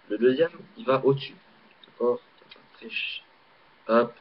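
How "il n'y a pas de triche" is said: whispered aside. Real, not acted. Repeats aside while doing trick.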